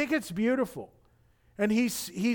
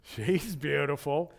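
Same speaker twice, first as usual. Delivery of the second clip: low, slow, dreamy admiring murmur; lovestruck guy; 4 semitones lower, much slower than usual. Retold or imitated. Imagined Boaz eyeing Ruth, lightly voiced smitten murmur. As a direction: savour the words like a smitten dude. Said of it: "the dude's like".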